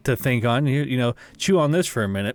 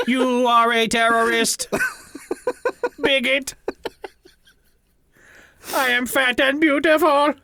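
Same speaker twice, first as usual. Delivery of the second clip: higher, stiff robot voice; 9 semitones higher than usual. Pretend. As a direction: read insults like a malfunctioning robot. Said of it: "Accessing insult database".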